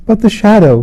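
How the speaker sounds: high moaning cry. A narrator's reading. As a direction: moan out in dread. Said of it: "she moaned".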